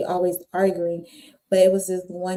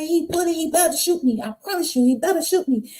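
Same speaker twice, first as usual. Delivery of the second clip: higher defiant challenge; defiant dare; 9 semitones higher than usual. Retold or imitated. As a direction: dare him to do it. Defying the boyfriend.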